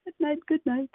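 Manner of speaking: normal talking voice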